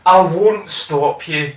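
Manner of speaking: mild, unhurried reassurance; slow, gentle permission to the crowd. Retold or imitated. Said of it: "he even said".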